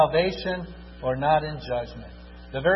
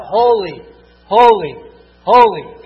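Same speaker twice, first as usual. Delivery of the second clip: holy shouted, louder each time; crying out; 2 semitones higher, 10 dB louder than usual. Acted. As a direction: proclaim it at full force, building. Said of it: "He's crying out".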